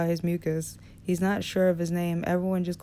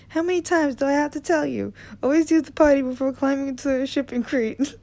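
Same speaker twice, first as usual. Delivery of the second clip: much higher, scolding mom voice through laughter; best mother voice; 8 semitones higher, 4 dB louder than usual. Acted. Hayden teasing Envy about the bathroom, in a high scolding mother voice. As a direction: nag like a mother scolding a child about the potty. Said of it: "putting on his best mother voice".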